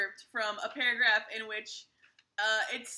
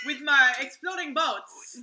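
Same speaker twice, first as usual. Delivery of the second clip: put-on slow, louder French accent; comic French inventor's accent, drawn out; mock French accent; 3 semitones higher, 6 dB louder, slower than usual. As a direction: proudly unveil it in a French accent. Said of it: "a much better French accent".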